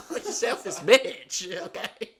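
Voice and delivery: jokingly scold, cracking up; mock insult. Son jokingly insulting mom, high and laughing.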